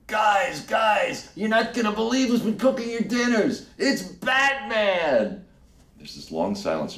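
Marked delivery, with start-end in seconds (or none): 0.1-5.4 high shouted announcement; calling out. Retold or imitated. Architect shouting to the dining hall, high announcement. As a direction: shout across the hall, drawing it out. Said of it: "you hear him go".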